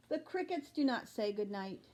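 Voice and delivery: plain reading voice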